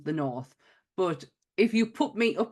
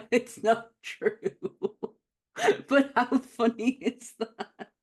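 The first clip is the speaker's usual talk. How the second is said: laughing through the words, voice a bit higher; can't stop giggling at the rumor; 6 semitones higher, slower than usual. Real, not acted.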